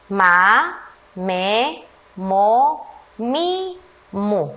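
put-on slow, separate syllables read aloud for children; syllable drill. Shown as a demonstration. Teacher leading first graders through the M syllables, each one drawn out. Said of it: "Todos leamos".

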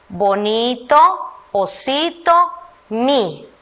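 slow words read one at a time. Shown as a demonstration. Teacher reading the jumbled words off the screen to the class. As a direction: read each word out slowly and separately. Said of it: "¿Está correcto que digamos".

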